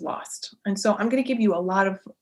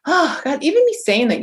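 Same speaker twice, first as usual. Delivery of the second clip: lit up and raised; real excitement; 6 semitones higher, 4 dB louder than usual. Real, not acted. Host hearing her own voice light up, excited. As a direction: let the thrill lift your voice. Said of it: "you can hear the inflection change in my voice".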